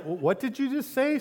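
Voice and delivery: high-pitched, stammered double take; jolted out of distraction, voice jumping up; startled. Retold or imitated. Distracted pastor startled by child's question.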